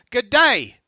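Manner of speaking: loud, hammed-up Australian "good day"; a broad put-on Australian greeting; playful Australian accent impression; mock Australian accent. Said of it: "I'm gonna give you some Southern Australian here".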